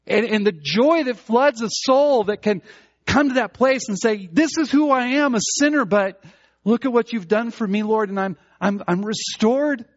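fervent preaching. Acted. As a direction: preach it with everything. Preaching at full force.

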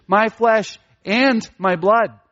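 "and" punched between pauses; slow, "flesh", then a stressed "and", then "blood"; emphatic repetition. Shown as a demonstration. Preacher shows Jesus emphasized both, stress on "and" joining "flesh" and "blood". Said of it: "There is this emphasis, there's repetition".

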